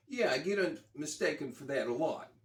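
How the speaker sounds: half-hearted New York accent, voicing the rock star; attempted New York accent. Retelling the rock star's reply in a tentative New York accent. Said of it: "I can't do a New York ac".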